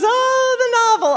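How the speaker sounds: hammed-up weary disbelief; affected mom. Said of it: "in this very affected fashion".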